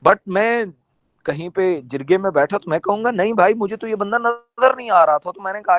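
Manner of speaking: ordinary talk, nothing marked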